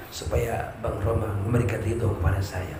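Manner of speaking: low, soft, giggly devoted wife; sweet devoted wife. Acted. Preacher voices wife Rika answering her husband, soft and sweet. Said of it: "Pasti ngomongnya begini lagi".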